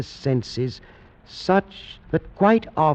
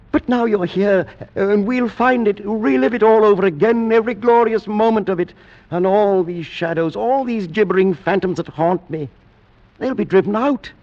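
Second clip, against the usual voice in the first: frenzied joy; 6 semitones higher, faster than usual. Acted. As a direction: let the joy run away with you. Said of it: "My excessive joy ... into a frenzy of talk".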